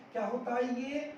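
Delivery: ordinary talk, nothing marked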